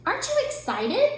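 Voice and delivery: very high bright bird question; high bird voice asking eagerly; chirpy Bird. A narrator's reading. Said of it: "Bird chirped".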